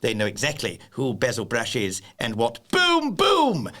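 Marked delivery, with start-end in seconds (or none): 2.7-3.7 big, high, booming punchline; showman's loud sign-off gag; catchphrase. Acted. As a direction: belt out the comic catchphrase. His own catchphrase, performed.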